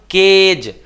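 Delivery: केज with a long, loud "ay"; model pronunciation. Shown as a demonstration. Teacher shows class how to read it, model pronunciation. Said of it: "इसको पढ़े क्या हम लोग".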